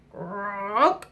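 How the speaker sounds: low, rising croak; single long rolled croak; frog croak. Acted. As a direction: imitate a frog's croak. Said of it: "it was the frog".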